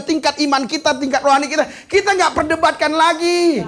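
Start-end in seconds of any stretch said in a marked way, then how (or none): none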